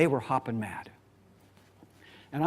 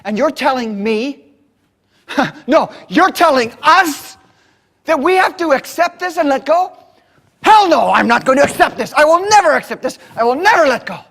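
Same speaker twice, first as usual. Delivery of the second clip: loud, high, fast, building defiant rant; furious outburst; 5 semitones higher, 11 dB louder than usual. Retold or imitated. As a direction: shout your refusal to let go. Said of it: "He says".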